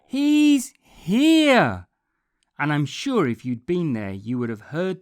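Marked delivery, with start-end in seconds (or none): 0.1-1.9 high, loud joyful shout; excited shout. A narrator's reading. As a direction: shout it out with joy. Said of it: "they shouted".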